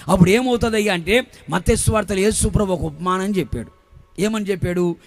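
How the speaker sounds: ordinary talk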